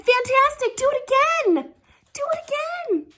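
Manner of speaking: high, loud, thrilled calling out; excited shout. Acted. Princess urges the dragon to fly again, excited shout. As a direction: cheer the dragon on at the top of your voice. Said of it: "Elizabeth shouted".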